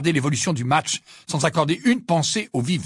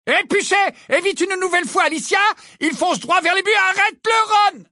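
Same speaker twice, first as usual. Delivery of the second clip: high call rising to a shout; urgent commentary. A narrator's reading. Lee begging Ron to make the save, shouting excitedly. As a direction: end on a desperate shouted plea.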